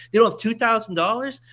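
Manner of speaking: high, loud outraged question; outraged at the bank; indignant disbelief. Real, not acted.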